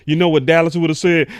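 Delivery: loud, heated build-up; shouting rant. Real, not acted. Host about to voice Dallas fans, loud. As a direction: raise the voice to set up the quote.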